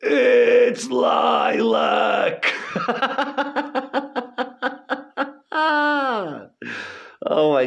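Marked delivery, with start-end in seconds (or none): 0.0-2.5 high, slow, sing-song holler; singing out a tease at a coworker; mocking yell. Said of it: "he goes". 2.6-7.0 long high burst of laughter; laughing hard at a buddy's joke; real laugh. Real, not acted.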